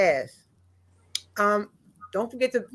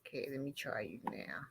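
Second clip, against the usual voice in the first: whisper; 10 semitones lower, 20 dB quieter than usual. Real, not acted. Testing a guest's audio.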